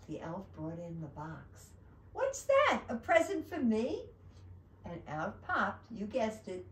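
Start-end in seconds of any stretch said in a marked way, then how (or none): 2.1-4.0 very high, loud storybook surprise; high, thrilled questioning; delighted surprise. Acted. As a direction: exclaim over an unexpected present. Santa receives a surprise box.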